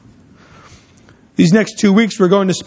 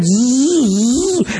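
mimicked plane buzz; buzzing noise; 3 semitones higher than usual. Pastor imitates the plane diving at the family, buzz. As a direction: buzz it past their heads.

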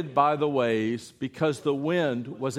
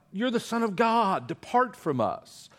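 mild cry; 6 semitones higher than usual. Acted. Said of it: "cried out".

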